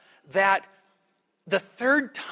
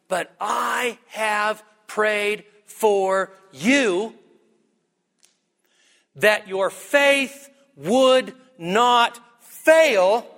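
very slow, word by word, building louder; emphatic promise; 2 semitones higher, 3 dB louder, much slower than usual. Acted. Jesus assuring Simon he has prayed for him, slow solemn emphasis. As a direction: weigh each word separately, rising to a loud close.

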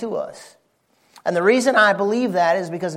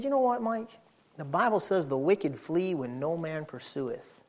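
much softer, tender advice; father softly teaching his son; quiet fatherly counsel; 4 semitones lower, 7 dB quieter than usual. Retold or imitated. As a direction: reassure the scared boy gently.

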